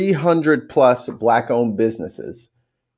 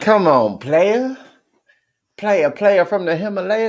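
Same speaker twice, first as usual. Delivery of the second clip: teasing; 7 semitones higher than usual. Acted. Teasing friend about light mode.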